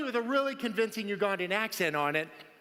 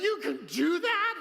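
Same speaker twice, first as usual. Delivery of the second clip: amazed gasp; 7 semitones higher than usual. Retold or imitated. Watching the surgeon draw, an amazed raised question. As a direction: gasp it out like you can't believe it.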